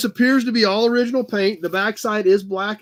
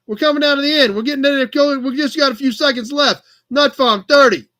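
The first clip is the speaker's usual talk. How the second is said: higher, hammed-up New York accent; terrible New York accent; 3 semitones higher, 3 dB louder than usual. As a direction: call the countdown in mock New Yorker. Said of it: "listen to me talk like that".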